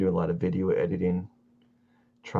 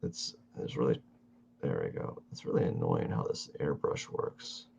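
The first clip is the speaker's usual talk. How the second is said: muttering; 12 semitones lower, 8 dB quieter than usual. Real, not acted. Muttering at the airbrush.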